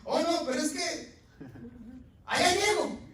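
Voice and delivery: high, loud mocking voice of a lame excuse; mock excuse-maker. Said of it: "Usted ha escuchado hablar que dice gente".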